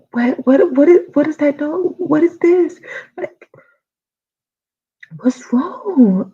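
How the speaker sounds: high, loud frightened questions; freaking out at the strange noise; panicked. Retold or imitated. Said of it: "I'm freaking out".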